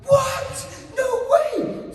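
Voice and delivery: very high, loud excited shout; acted squeal of amazement, full volume; thrilled disbelief. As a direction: cry out like a kid who just won. Reacting to getting Star Wars.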